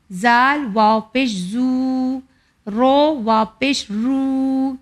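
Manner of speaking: slow drill, each final oo held long; stretched oo. Shown as a demonstration. Teacher drilling waw madd for children, slow stretched oo. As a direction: draw out every oo for two beats. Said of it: "اب ہم پڑھتے ہیں واو مدی".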